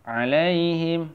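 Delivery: "Alaihim" slowly, ending on a closed m; standalone form. Shown as a demonstration. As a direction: chant "Alaihim" with a drawn-out ai. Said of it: "Ini gampang ya".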